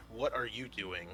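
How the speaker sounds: ordinary talk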